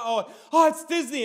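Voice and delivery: mock delighted first-timer; a touch of childlike wonder; amazed. Wonder at Disney.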